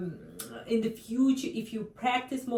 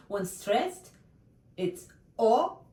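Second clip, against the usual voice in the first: ordinary talk, nothing marked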